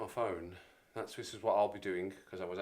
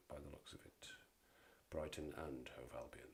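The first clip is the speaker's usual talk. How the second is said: whispered aside; 6 semitones lower, 13 dB quieter than usual. Real, not acted. Aside during fixtures read.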